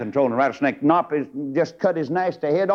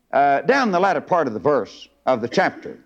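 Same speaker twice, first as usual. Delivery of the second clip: ordinary talk, nothing marked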